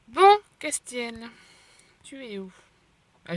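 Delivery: ordinary talk, nothing marked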